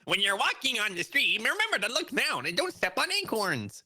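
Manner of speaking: high, squeaky squirrel voice. Pretend. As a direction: chirp it out like a squirrel. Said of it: "that's my squirrel P S A".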